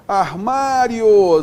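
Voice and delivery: slow emphatic teacher example; drawn-out demo. Shown as a demonstration. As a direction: drag out Armário to show the io glide. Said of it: "semivogal mais vogal".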